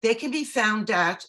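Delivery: ordinary talk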